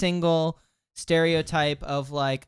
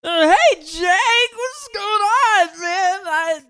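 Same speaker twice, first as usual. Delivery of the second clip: very high, strained, slow and wobbling shout; Bobcat Goldthwait impression; 3 dB louder, slower than usual. Shown as a demonstration. Podcaster does his impression on request, high strangled drawl. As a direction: push it up high and drag the words out. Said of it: "I can do a good Bobcat".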